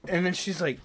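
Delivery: ordinary talk, nothing marked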